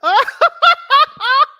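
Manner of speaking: loud squealing laugh; shrieking cackle; high laughing shriek. Real, not acted. Big laugh.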